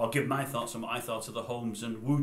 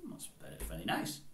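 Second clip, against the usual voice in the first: sarcastic aside; 8 semitones lower, 8 dB quieter than usual. Real, not acted. Sarcastic aside on quoted words.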